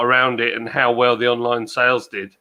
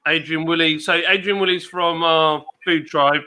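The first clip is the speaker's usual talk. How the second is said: ordinary talk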